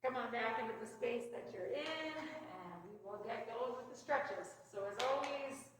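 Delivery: soft, soothing meditation voice; guided mindfulness. Real, not acted. Leads stretches and guided breathing.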